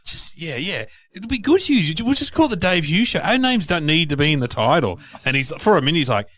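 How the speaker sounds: high, light mock coaxing voice; imitating Kate. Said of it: "Kate's like".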